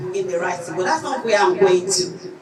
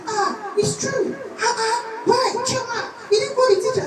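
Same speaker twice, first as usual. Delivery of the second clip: very high, squealing mock surprise; a high, squeaky mock exclamation of surprise; surprised principal; 13 semitones higher than usual. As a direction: imitate the principal's shocked high cry.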